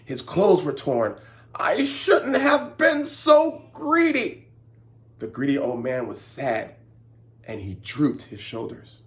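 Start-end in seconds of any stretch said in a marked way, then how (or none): 1.5-4.5 high slow lament; regretful old man. Acted. Greedy old man regretting his greed. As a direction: mourn your greed.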